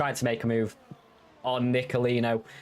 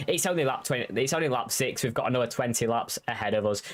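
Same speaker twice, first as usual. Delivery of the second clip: ordinary delivery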